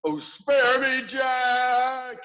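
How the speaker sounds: very high, loud pleading yell. A narrator's reading. Giant begging Jack for mercy, yelling. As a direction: beg at the top of your voice. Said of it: "he yelled".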